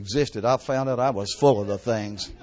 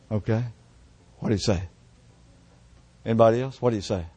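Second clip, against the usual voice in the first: usual speaking voice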